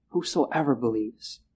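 "whosoever believes" dropped low and soft; quiet, low echo; soft repeat. Shown as a demonstration. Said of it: "two words to emphasize".

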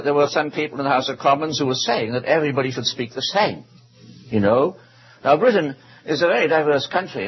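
ordinary talk

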